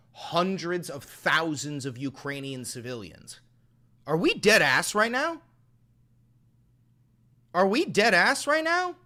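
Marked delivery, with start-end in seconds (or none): 4.1-5.4 loud, high outraged disbelief. Real, not acted. Streamer outraged at chat comment. 7.5-9.0 much higher, louder yell of disbelief; outraged yell. Real, not acted. Repeats, even more outraged.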